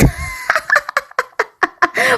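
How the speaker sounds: high, bubbling giggle at own slip; high giggling fit; amused laugh. Real, not acted. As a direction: crack up in a high, soft run of laughter.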